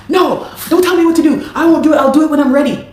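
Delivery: imitating a man yelling his refusal; angry shout. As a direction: bark it back like a defiant boss. Acting the manager's angry retort.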